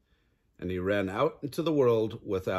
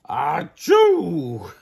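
big, loud, higher "achoo"; fox's acted sneeze; 6 semitones higher, 11 dB louder than usual. Fox sneezes all the birds out, huge loud achoo. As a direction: explode into a drawn-out mock sneeze. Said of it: "the fox sneezed".

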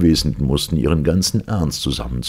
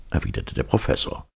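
ordinary delivery, nothing marked

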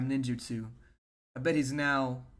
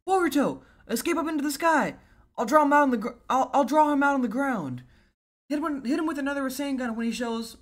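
high, loud battle instructions; raised, pitched-up character shout; urgent young fighter; 11 semitones higher, 7 dB louder than usual. Acted. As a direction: call out the plan urgently. Voices a character shouting battle orders.

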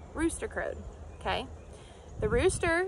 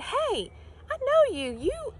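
high, pointed voice of a woman calling him out; accusing woman; 13 semitones higher than usual. Acted. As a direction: catch him out like a nosy bystander. Woman recognizes Peter in crowd.

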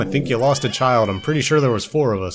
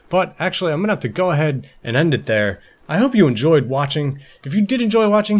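higher, louder upbeat sign-off; YouTube outro; 5 semitones higher, 4 dB louder than usual. Acted. YouTuber ending the video for viewers, upbeat outro. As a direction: pitch the channel brightly.

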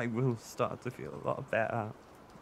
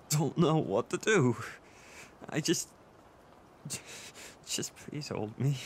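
crying, with stammered starts and raised outbursts; upset, breaking off mid-thought; tearful distress. Acted. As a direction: plead through tears, halting between phrases. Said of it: "crying like a little baby right now".

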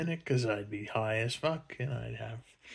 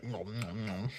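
soft lowered nom nom; mock eating noises; 3 semitones lower, 9 dB quieter than usual. Rey eats a donut for the viewer, soft mock nom nom. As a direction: quietly fake munching. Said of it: "watch me eat a donut".

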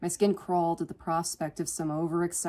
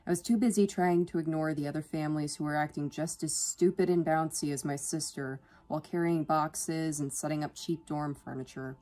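ordinary delivery

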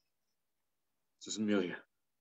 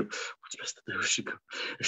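whispering; 10 dB quieter, faster than usual. Acted. Othello panicking in hiding.

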